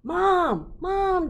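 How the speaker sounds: put-on high, loud child calling out; urgent call. Child calling Mom downstairs, high and loud.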